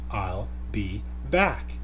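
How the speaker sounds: very slow, each word set apart; clearly enunciated quote. Retold or imitated. Narrator quoting Arnold's famous line for viewers, clearly enunciated. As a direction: space the words out, rising on back. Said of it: "He can clearly say the words".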